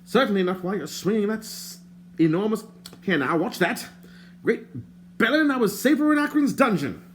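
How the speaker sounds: lightly voiced fussy stranger. A narrator's reading. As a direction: play the fussy bard, put out.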